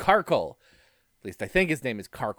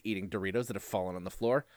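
normal talking voice

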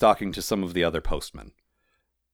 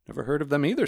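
raised-pitch character voice of a doubter; high-voiced dismissive postman; sceptical postman; 7 semitones higher, faster than usual. A narrator's reading. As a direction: pipe up with mild disbelief. Postman Tropes voiced in story.

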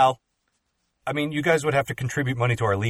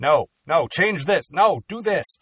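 bossy demanding; 7 semitones higher, 5 dB louder, slower than usual. Retold or imitated. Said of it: "I was a little".

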